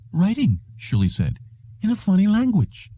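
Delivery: put-on high, wondering young voice; a touch of excited receptionist. A narrator's reading. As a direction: sound amazed by the strange script. Shirley describing the script.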